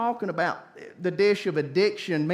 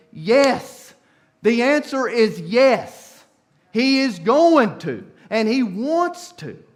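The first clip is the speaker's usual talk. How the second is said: louder, slow, hammering yes; emphatic preaching; 3 semitones higher, 7 dB louder, slower than usual. Real, not acted. Preacher answering the doubters, forceful and slow. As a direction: proclaim it slowly with force.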